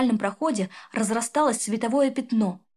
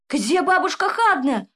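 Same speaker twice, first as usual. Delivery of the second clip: higher, louder, slow cry of horror; terrified cry; 6 semitones higher, 6 dB louder than usual. Acted. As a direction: shout for grandmother in terror. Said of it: "в ужасе закричал Егор". (крик в ужасе)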